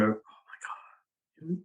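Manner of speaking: slow, breathy whisper of dismay; hushed disappointed whisper; whispered aside. Retold or imitated. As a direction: whisper it as if no one may hear. Retold private reaction to a hero.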